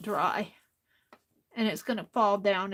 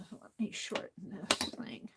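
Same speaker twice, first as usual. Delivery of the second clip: whisper; 2 semitones lower, 9 dB quieter than usual. Real, not acted. Quiet crafting aside.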